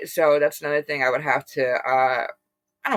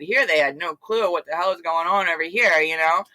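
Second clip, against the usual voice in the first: usual speaking voice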